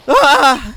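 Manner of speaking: sharp startled scream, loud; sudden scream. Acted. Maya startled by the hanging scroll, loud scream. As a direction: shriek in fright. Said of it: "Why the hell do you scream like that?".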